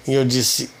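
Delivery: normal talking voice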